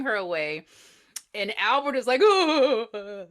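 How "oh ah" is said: loud, laughing mock sobs; overdone fake sobbing. Albert fake-weeping as Granny is wheeled away, hammed-up sobs. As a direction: ham up phony grief. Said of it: "don't you think you're overdoing it".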